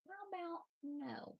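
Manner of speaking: high sing-song "How about no?" to the kitten; high playful scolding of kitten; sing-song pet talk. Real, not acted. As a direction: coo the refusal up high. Telling kitten off, pitched up.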